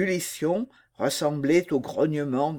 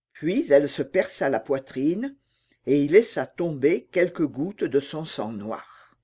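plain reading voice

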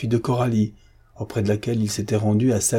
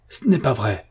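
exclaimed; 2 semitones higher than usual. A narrator's reading. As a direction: burst out with the denial. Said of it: "s'exclama-t-il". («ce n'est pas vrai» s'exclame plus fort)

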